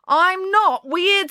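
high, slow, stung denial; offended boy's protest. Acted. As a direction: push back against the insult. Boy protests being called weird.

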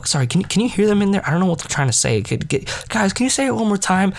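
high-pitched imitation of a teacher; high, put-on pestering; exaggerated appeal. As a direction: plead in a hammed-up high voice. Teacher acting out mock complaint.

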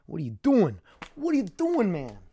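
high, mocking cry at himself; exasperated self-scolding. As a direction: scold yourself in a squeaky exasperated voice. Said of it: "It's like".

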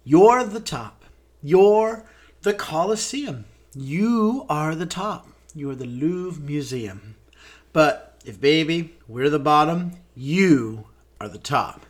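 slow, grand spoken song lyric; theatrical lyric recital. Acted. Host flattering the audience with show lyrics, theatrical recital. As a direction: declaim each line with drawn-out showmanship.

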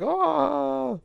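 a sudden high "Ah!" of awe, in a different voice; high excited gasp. Retold or imitated. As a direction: gasp up high like you just spotted a giant TIE fighter. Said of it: "which I was like".

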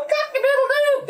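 high, sustained storybook crowing; rooster crow. A narrator's reading. Rooster joins the animal band, crowing high. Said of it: "The rooster crowed".

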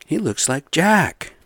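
high, slow amazed "Dude"; drawn out like spotting a lookalike; excited exclaim. Retold or imitated. Telling a friend the senator looks like Jack, slowed and amazed. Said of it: "I'm like".

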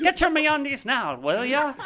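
a hint of an Irish accent in an ad pitch. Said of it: "my Irish accent".

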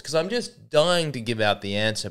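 usual speaking voice

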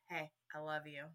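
a father's hushed, tender "Hey, I love you." before the aisle; tender dad. Retold or imitated. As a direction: say it quietly to your daughter. Dad tenderly tells bride daughter.

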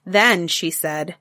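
ordinary delivery

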